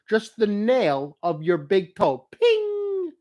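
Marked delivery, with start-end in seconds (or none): 2.3-3.1 piercing high cry of ping; sharp high ping noise. Retold or imitated. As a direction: yelp the sound of the toe hit. Toe hitting colander.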